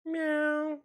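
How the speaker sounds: high kitty mew at the door; cute cat meow. Pretend. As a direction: mimic a cat asking to be let in. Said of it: "Would you let me in".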